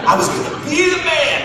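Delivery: mock heroic boast; bravado. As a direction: puff up like a hero. Hero fantasy bravado.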